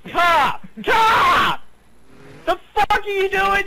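shouting. Real, not acted. Warning about the car.

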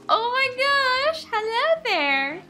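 high slow cooing squeal; excited squeal. Real, not acted. Player greeting a newborn animal in a high coo. Another offspring arriving.

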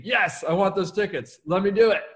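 high, eager, punchy acceptance; emphatic yes. Acted. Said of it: "Example of emphatic yes".

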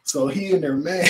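ordinary talk

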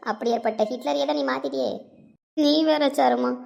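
normal talking voice

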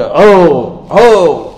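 repeated joyful whoops; triumphant cry. Acted. Successful soul holding the book, triumphant cry. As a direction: call out the slogan in short bursts. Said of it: "وہ ایک نعرہ لگائے گا".

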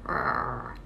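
low soft throaty growl; angry dog growl. Shown as a demonstration. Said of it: "That's, that's the sound".

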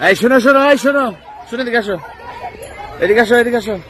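yelling across the room. Real, not acted. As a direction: shout them over from afar. Reporter calling children over loudly.

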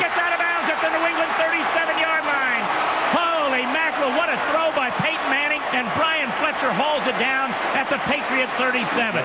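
announcer bursting with excitement on the play; excited call. Real, not acted. Broadcaster calling a long completion to listeners, excited. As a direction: pitch jumps high on a big catch.

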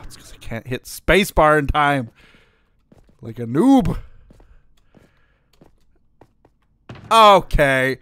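loud exasperated complaint; frustrated. Real, not acted. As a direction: vent about the controls. Complaining about game.

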